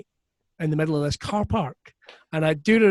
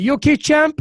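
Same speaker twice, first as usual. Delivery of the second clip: higher, louder gruff wrestler voice; Roddy Piper impression; 10 semitones higher, 8 dB louder than usual. Wrestler Roddy Piper asks the young comic if he's okay, voice higher and louder. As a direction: play the big wrestler checking on him. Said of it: "And he's like".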